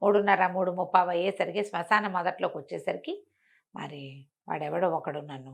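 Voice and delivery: ordinary delivery, nothing marked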